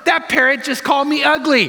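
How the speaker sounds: high-pitched acted indignation; indignant complaint. Woman tells the store owner on his parrot, indignant. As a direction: complain high, as the offended customer. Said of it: "she goes to the owner".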